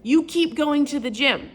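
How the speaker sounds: high, loud heated argument; retelling arguing with her husband, heated; heated retort. Retold or imitated. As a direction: argue back hard, voice up. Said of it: "And I said".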